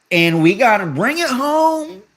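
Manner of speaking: imitating Kamala dropping into a folksy voice; code-switched Kamala. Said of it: "completely code switch".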